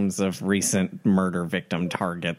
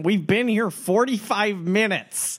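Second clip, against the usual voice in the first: higher, slower, stretched out; a touch of exasperation; exasperated; 11 semitones higher than usual. Real, not acted. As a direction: spell it out in disbelief. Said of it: "player exasperated repeat".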